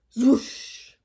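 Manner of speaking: breathy swoosh effect; whooshing cape sound. A narrator's reading. Reading to kids, making the cape swoosh sound.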